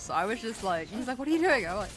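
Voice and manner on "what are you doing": high, loud alarmed question; alarmed husband. Retold or imitated. The husband sees her grab the spider, loud mock alarm.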